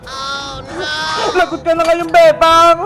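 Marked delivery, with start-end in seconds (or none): 0.1-0.9 much softer, lower dismayed oh no; worried murmur. Acted. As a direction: breathe it out in dread. Friend dreads the mother coming.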